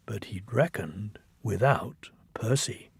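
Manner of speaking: hushed ominous whisper. A narrator's reading. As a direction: breathe it like a secret. Ominous narration turn.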